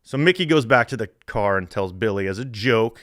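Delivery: ordinary talk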